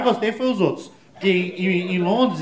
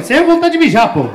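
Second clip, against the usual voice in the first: mock excuse; 8 semitones higher, 5 dB louder than usual. Acted excuse, high voice.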